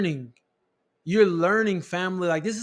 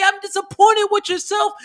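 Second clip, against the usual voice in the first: high, loud shout of mock self-scolding; shouted self-blame; 12 semitones higher, 6 dB louder than usual.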